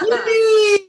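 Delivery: very high, loud squeal of joy; squealing yippee. Real, not acted. Host joins the laughter cheer, squealing. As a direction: shriek it out with delight.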